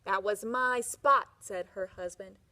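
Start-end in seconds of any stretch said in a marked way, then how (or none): 0.0-1.3 slow, loud, indignant. Acted. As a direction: insist angrily it was his spot. Husband insisting on spot.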